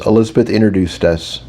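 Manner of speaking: plain reading voice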